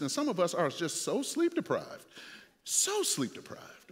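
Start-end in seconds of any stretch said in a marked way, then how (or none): 2.6-3.9 higher, softer and slow, drawn out; softer, slower, higher echo of the tiredness; weary echo. Acted. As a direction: sigh it out like you're exhausted. Echoed softly and slowly.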